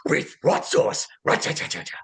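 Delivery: high Scooby voice, every word starting with R; Scooby-Doo voice. Shown as a demonstration. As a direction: say it like Scooby, then a raspy rolled R. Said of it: "You know, that line".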